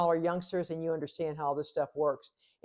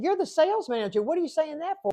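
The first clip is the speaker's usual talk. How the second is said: pitched way up in disbelief; incredulous; 9 semitones higher than usual. Retold or imitated. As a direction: ask it high, baffled at the salesman. Scolding the salesman's odd pitch.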